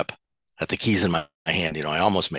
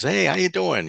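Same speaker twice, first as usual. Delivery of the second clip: bright high coworker hello; breezy greeting; 13 semitones higher, 3 dB louder than usual. Retold or imitated. Coworker greeting him in the morning, high and breezy. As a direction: toss off a cheery "how you doing".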